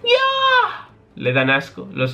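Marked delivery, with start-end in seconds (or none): 0.0-0.9 very high startled yelp; surprised shriek. Acted. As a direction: shriek at the bugs. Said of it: "quien sorprendida dice".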